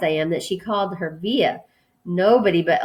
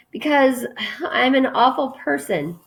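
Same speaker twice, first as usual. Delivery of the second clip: higher-pitched, distressed admission; lightly acted upset, voice pitched up; tearful confession; 7 semitones higher than usual. A narrator's reading. Crying girl confesses in the story.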